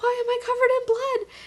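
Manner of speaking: high, panicked little voice; a scared kid asking in shock; the frightened Broo asking why he is covered in blood; bewildered fright. Retold or imitated. Voicing a confused, scared character.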